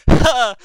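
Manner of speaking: loud, high single "ha"; hammed-up bark of laughter; fake amused laugh. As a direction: burst out one mock laugh. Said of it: "until they're like".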